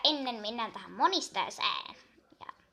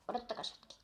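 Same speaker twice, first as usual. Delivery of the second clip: whisper; 4 semitones lower, 9 dB quieter, much faster than usual. Real, not acted. Aside to viewers, whispered.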